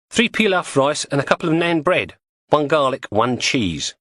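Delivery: lightly voiced cockney woman; Julie's voice. Julie lists the bread.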